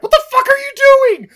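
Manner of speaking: loud, high-pitched outburst of disbelief; outraged yell. Acted. Podcaster imagining himself berating the diamond thrower, outraged yell. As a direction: explode at them in mock outrage. Hypothetical outraged rant at Dylan Walsh.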